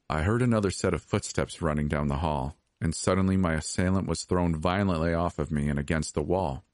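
ordinary delivery, nothing marked

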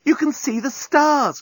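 very high, drawn-out delight; excited cry. A narrator's reading. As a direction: cry it slowly, pitched right up. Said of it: "cried Nettie".

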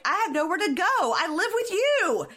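high, slowing mock wail; imitated desperate shout. He calls after her as she drives off, mock desperate shout. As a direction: cry it out after someone leaving, hammed up.

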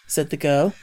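plain reading voice

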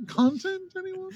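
very high, silly little offer; hammed-up tiny voice; mock host. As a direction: squeak it out as a feeble pitch. Mocks host's segue after the feat.

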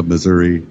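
"Missouri" with an "ee" ending; standard pronunciation. Shown as a demonstration. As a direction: end "Missouri" on a clear "ee", "Muh-ZUR-ee". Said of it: "It's not Missouri".

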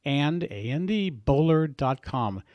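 letter by letter, slow; spelled out slowly. Shown as a demonstration. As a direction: spell it out for the listener. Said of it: "their website is Bowler".

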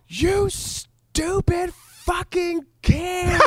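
high shrieking curse, mocking the pastor's wife; a retold high-pitched furious shriek at a child; mock furious screech. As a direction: screech it like an enraged church lady.

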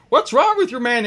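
high gnome demand; agitated gnome. Acted. Gnome shaking his dazed orc, high agitated demand. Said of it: "he's like".

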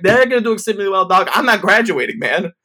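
high, loud, rapid protest; frantic mock yell; panicked outburst. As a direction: blurt it out in acted panic. Panicked comeback to professor's speech.

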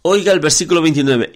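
ordinary talk, nothing marked

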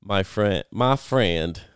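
strong Southern preacher drawl; J. Vernon McGee. Retold or imitated. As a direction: put on an emphatic Southern accent. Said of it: "Very, like, strong Southern".

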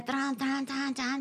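high, hushed repeated action sound; imitated pumping noise. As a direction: mimic the machine working away, softly.

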